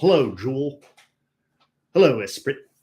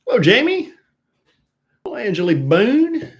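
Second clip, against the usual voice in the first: high bright sing-song greeting; sing-song hello; 9 semitones higher, 7 dB louder, faster than usual. Real, not acted. Host greeting a listener, sing-song.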